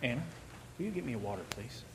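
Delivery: very soft, low request; off-the-record murmur to a helper; quiet aside. Real, not acted. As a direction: drop right down for a private aside. Quiet aside asking for water.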